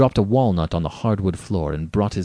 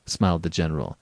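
ordinary delivery, nothing marked

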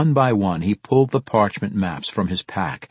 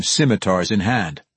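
ordinary delivery, nothing marked

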